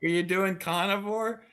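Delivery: slow, high drawled carnivore question; Maine accent. Retold or imitated. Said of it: "what the hell's that".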